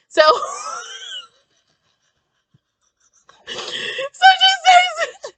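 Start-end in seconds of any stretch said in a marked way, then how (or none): 0.2-5.3 laughing. Real, not acted. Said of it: "laughing, voice strained".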